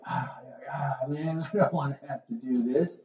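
soft, groaning reluctance; acted dread of having to discipline, groaned softly; reluctant parent.

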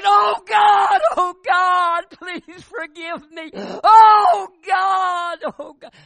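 shrieking high and loud to God; anguished outcry. Retold or imitated. Wife suddenly bursts out crying to God in the car, shrieking. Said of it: "She said".

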